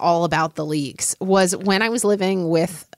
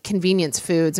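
normal talking voice

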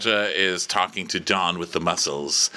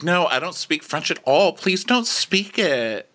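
higher, hammed-up brush-off; imitated airy refusal; 9 semitones higher than usual. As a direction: mimic a breezy refusal, pitched up. Mimicking Victoria refusing to speak French.

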